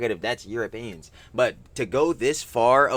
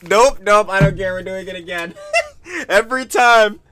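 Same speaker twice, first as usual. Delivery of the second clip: laughing; 11 semitones higher, 8 dB louder than usual. Real, not acted. Laughing fit over clip.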